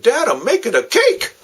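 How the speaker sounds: put-on high, bright child's boast; proud little boy. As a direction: chirp it like an innocent kid. Son proudly explains mess.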